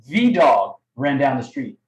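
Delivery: "The" said as a strong "thee", set apart; stress on THE. Shown as a demonstration. Coach showing how many dogs, stress on "The". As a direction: hit "The" hard, as the one and only. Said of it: "emphasis of how many dogs".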